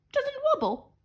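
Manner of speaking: a panicked cook squeaking in disbelief; flustered cook. A narrator's reading. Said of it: "flustered the cook".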